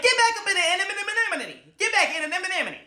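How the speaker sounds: high, loud mom yelling; lightly voiced scared mom. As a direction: play the panicking mother. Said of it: "she's like, like".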